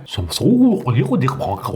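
senza olio di palma with a light French lilt; French accent. Shown as a demonstration. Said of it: "onların okunuşları farklı".

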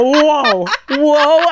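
loud, drawn-out flustered whoa; panicked whoa. Retold or imitated. Storyteller imitating own botched greeting, loud flustered blurt. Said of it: "I go".

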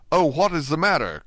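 high, loud anxious question; alarmed cry. A narrator's reading. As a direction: cry out in sudden concern. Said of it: "cried Dante".

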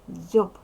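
Дзьоб very loud, with a voiced final B; loud, distinct Дзьоб ending in B, not P; clear voiced ending. Shown as a demonstration. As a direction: ring out the Б at the end of Дзьоб. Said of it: "Вимовляй чітко".

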